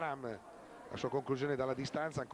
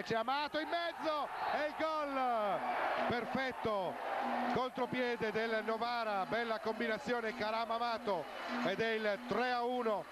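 high, excited goal call; breathless italian goal commentary; 5 semitones higher than usual. Real, not acted. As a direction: lift the voice for the goal. Third goal called live.

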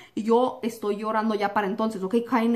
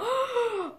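shocked gasp; 11 semitones higher, 5 dB quieter than usual. Retold or imitated. Shocked child after being hit.

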